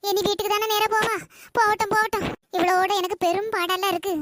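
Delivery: ordinary delivery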